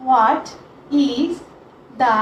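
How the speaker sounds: each word set apart by long pauses; word-by-word dictation. Shown as a demonstration. Teacher dictating question one to students, word by word.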